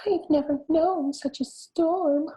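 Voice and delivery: very high, slow squeaking mother mouse; squeaky mouse. A narrator's reading. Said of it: "squeaked Mother Mouse".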